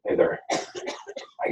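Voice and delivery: normal talking voice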